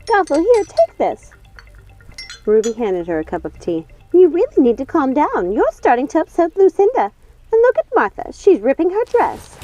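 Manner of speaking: high, anxious soothing; calm Gothel while jittery; nervous Ruby. Acted. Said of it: "her hands trembling".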